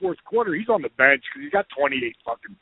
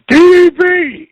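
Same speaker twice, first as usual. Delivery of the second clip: much higher, loud, drawn-out call of the name; Barkley spotting him backstage, shouting much higher and louder; imitated big shout; 13 dB louder, slower than usual. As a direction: bellow the name across the room.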